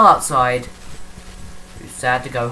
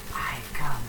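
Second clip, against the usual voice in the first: menacing whisper; 3 semitones lower, 6 dB quieter than usual. Pretend. Pretend villain announcing arrival, whispered. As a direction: breathe it out like a lurking villain.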